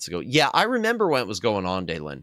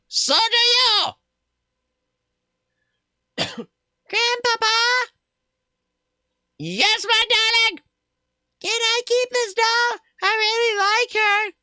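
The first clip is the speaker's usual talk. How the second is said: much higher, slower put-on family voices; high squeaky character voices; 3 dB louder than usual. Streamer voicing a child asking grandpa for a doll.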